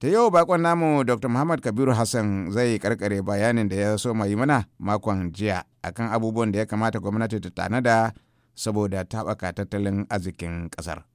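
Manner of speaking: ordinary talk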